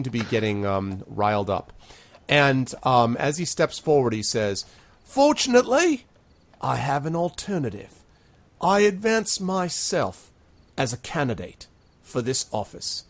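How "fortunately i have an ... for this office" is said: self-important public declaration; grand announcement. Acted. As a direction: present yourself as the candidate. Sharky proposes himself as mayor.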